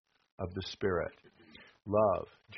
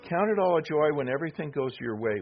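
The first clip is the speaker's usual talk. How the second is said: mock misreading; 5 semitones higher, 5 dB louder than usual. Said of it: "I got the wrong translation here".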